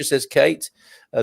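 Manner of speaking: ordinary talk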